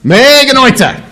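high, stretched, emphatic exclamation; excited catchphrase. Acted. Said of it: "that's one of my favorite sayings".

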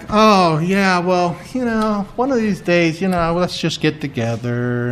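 soft, offhand mock invitation; casual brush-off. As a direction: trail along quietly, as if it hardly matters. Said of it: "it wasn't like".

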